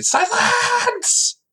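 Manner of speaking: very high-pitched, drawn-out mock cry; mock command. Host quoting an obscure reference, theatrical high-pitched command. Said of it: "gonna get this reference".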